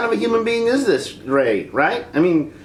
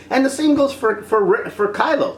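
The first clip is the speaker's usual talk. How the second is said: usual speaking voice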